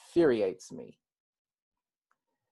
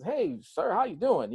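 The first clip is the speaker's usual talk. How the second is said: much higher, chummy imitated greeting; suddenly friendly; 7 semitones higher than usual. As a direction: switch to a mock-friendly hello. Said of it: "his tone completely changes".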